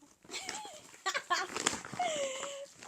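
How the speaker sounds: very high-pitched squealing laugh; real high, giddy laughing; delighted laugh. Real, not acted. Delighted reaction to Barbie gift.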